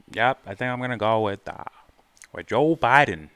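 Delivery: put-on half-hearted Minnesota accent. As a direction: try on a Minnesota accent for "with Joe Biden". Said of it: "That was my, uh, Minnesota accent I tried".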